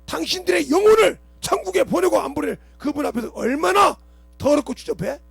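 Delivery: 설교하듯 목청을 높여 외치듯; 회중을 꾸짖으며 크게 외치는 설교; preaching at full force. Acted. (큰 소리로 꾸짖는 설교)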